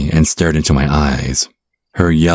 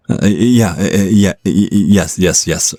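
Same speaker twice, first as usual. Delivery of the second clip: higher, stumbling nervous yeses; flustered stammer; 3 semitones higher than usual. Acted. Emmett stammers back at his date after her odd remark, flustered and nervous. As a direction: trip over the words, caught off guard. Flustered reply to vampire's hint.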